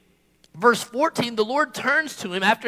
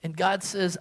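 ordinary delivery

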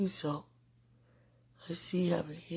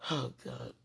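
ordinary talk, nothing marked

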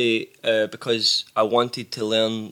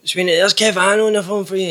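higher, louder impression of the pal's dad; imitating pal's dad; 8 semitones higher, 6 dB louder than usual. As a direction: do the pal's dad calling his son. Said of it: "he'll be like".

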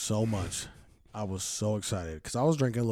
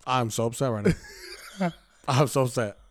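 laughing through the complaint. Retold or imitated. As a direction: say it while laughing. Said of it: "I was like".

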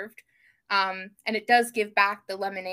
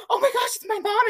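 gasp, then a high excited squeal; retold surprised gasp; 13 semitones higher, 4 dB louder than usual. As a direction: relive the shock of seeing mom at the door. Retold surprise at mom's visit.

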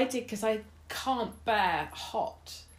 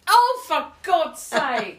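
exasperated; 3 semitones higher, 8 dB louder, much slower than usual. Real, not acted. Realising it was wasabi.

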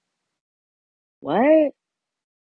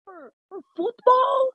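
high mock crying wail over the name; high, half-crying mock lament; 11 semitones higher than usual. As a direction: wail it like heartbroken fan, much higher. Mock outrage over Messi's pay.

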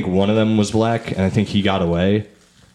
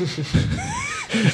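shrieking laugh; 6 dB quieter than usual. Real, not acted. Laughing at own story.

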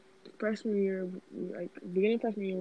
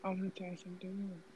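whispering; 12 dB quieter, faster than usual. Real, not acted. Whispered secret to friend.

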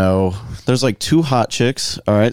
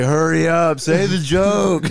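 higher, slow, mock-impatient heckle; impatient heckler; 7 semitones higher, slower than usual. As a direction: impersonate the heckler, hammed-up. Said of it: "He's like".

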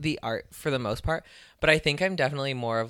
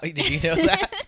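mock question; 13 semitones higher, 5 dB louder than usual. Jamal's question, retold.

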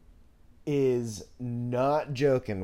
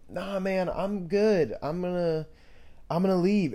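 high-pitched, easygoing brush-off; retold polite refusal; 6 semitones higher than usual. Skater turns down the upset kid, high-pitched refusal. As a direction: beg off in a higher voice.

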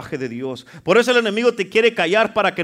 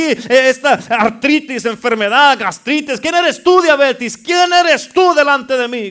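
high, loud, repeated challenge; fiery rebuke; 6 semitones higher, 8 dB louder than usual. Acted. Preacher rebuking problems before the congregation, fiery and loud. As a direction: hammer each question at the problem.

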